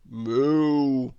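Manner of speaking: long drawn-out moo; cow mooing. Acted. Cows in the farmyard noise, mooing. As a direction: imitate a cow's steady moo. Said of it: "mooed the cows".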